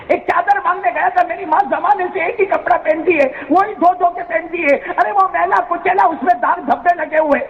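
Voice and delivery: put-on high, aggrieved child explaining; hurt pleading boy. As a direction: plead the injustice, pitched high. Beaten boy explains to stranger.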